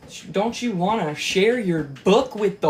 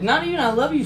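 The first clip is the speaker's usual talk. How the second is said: ordinary delivery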